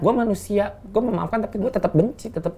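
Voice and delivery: high-pitched, wounded declaration; mock-emotional outburst; acted tearful hurt. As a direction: play it close to tears, pitch lifted. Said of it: "jangan nangis".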